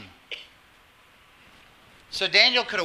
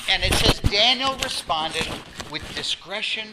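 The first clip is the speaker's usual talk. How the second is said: usual speaking voice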